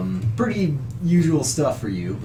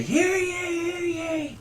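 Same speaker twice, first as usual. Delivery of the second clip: high, held-out mock crowd cry; small, high voices chiming in from afar; crowd calling out; 4 dB quieter than usual. Townsfolk in the crowd back up their priest, high mock calling. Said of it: "a few other people in the crowd say".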